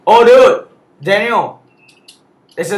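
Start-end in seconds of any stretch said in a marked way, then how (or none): 0.1-1.6 alarmed shout. Real, not acted. Warning teammate in a fight.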